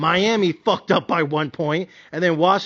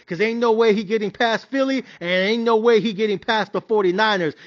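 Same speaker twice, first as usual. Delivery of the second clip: ordinary talk, nothing marked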